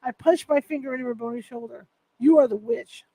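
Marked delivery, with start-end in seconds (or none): 2.2-3.1 louder, firmly forceful accusation. A narrator's reading. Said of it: "in a forceful voice".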